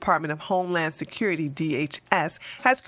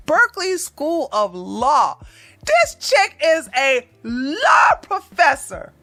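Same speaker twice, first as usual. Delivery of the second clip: incredulous; 6 semitones higher, 5 dB louder, slower than usual. Real, not acted. Disbelief at the professor.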